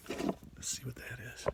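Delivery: hushed, curious whisper; whispering to the kids about a mystery object. Acted. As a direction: whisper as if discovering something secret. Peeking at a new find.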